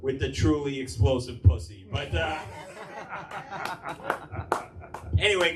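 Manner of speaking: ordinary delivery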